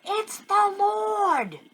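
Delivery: high, slow, drawn-out cry; yelled out. Acted. John crying out to Peter from the boat, yelled slowly. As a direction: cry it out slowly in joyful recognition. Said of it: "he yells out".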